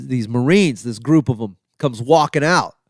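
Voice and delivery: ordinary delivery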